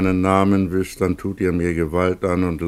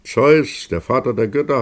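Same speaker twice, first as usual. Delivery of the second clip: higher and louder, resentful; bitter cry; 6 semitones higher, 5 dB louder than usual. Acted. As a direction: spit out the god's name. Plutos names his punisher.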